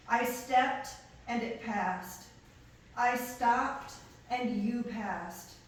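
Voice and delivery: measured poem recital, with pauses between phrases; poetry reading. Acted. Poet reading own work to an audience, measured poetry recital. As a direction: read it line by line, projected and deliberate.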